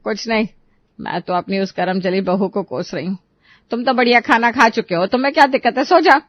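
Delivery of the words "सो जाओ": loud, high-pitched order; irritated dismissal. Acted. Wife orders husband back to sleep, irritated. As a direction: shut him up crossly.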